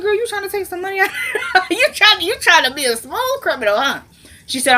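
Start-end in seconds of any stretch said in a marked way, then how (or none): 0.0-1.1 high, fast, laughing jab; laughing tease. Real, not acted. Teasing the woman grabbing wallet. 1.2-1.7 squealing laugh; high shriek of laughter. Real, not acted. Laughing at wallet grab. 1.7-4.0 laughing tease. Real, not acted. Teasing the woman grabbing wallet.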